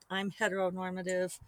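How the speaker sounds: ordinary talk, nothing marked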